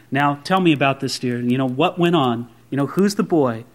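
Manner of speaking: lightly voiced, low-pitched gentle questioning; a hint of a concerned counselor, pitched low; youth leader questioning. Youth leader sits down with pregnant girl, lightly acted questioning. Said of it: "the youth leader goes, talks to her".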